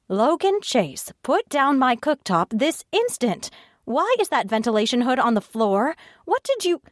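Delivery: high, ringing command to stop; clear and commanding. A narrator's reading. Natalia storms into the kitchen to stop Logan, commanding shout. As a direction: order him to drop it, right now. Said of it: "her voice rang out clear and commanding".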